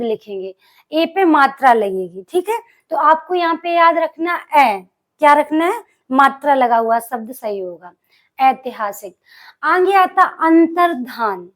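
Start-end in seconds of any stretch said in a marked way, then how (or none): none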